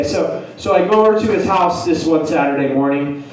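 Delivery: ordinary talk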